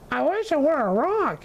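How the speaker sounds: put-on high, eager child's voice; a small donkey blurting his wish; young donkey voice. A narrator's reading. Sylvester the donkey, in a panic, wishing aloud. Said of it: "he said".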